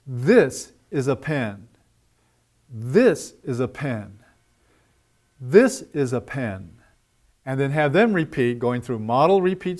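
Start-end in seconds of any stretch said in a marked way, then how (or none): 0.1-6.7 two beats, on "This" and on a falling "pen"; stress on THIS and PEN. Shown as a demonstration. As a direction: punch "This", skip lightly over "is a", land on "pen". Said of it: "stressed on this and pen".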